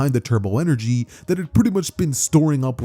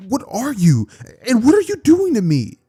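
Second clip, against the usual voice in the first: rising, high panicked questions; 10 semitones higher, 4 dB louder than usual. Acted. Boy panicking at the alien on his chest, high and frantic. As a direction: get more frantic with each question.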